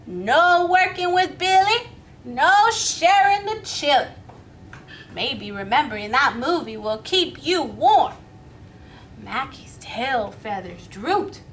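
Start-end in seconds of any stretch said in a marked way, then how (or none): 0.1-8.2 louder, firm sing-song refusal; sharp refusal. Acted. Miss Billy brushing off Mackie, loud and firm. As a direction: chant the rule to shut him down.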